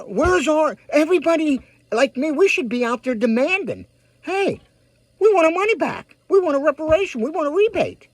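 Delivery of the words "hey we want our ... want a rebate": higher-pitched imagined rallying chant of demands; mock crowd demand. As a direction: voice a crowd calling out their demands. Imagined crowd demanding money back.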